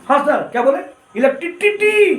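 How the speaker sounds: put-on high-pitched child lisping ट्रिसिटी as टिटिटी. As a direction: mimic a small lisping boy saying electricity. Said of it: "बच्चा तुतला था". (तुतलाते बच्चे की ऊँची आवाज़)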